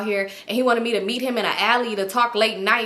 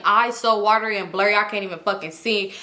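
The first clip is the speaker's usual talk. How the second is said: ordinary delivery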